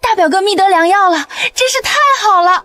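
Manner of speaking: high, excited rejoicing; delighted relief. Acted. Cousin hears the prince's brother has medicine, delighted. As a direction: burst out happily, pitch climbing.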